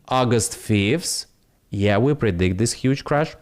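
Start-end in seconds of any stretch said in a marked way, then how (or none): none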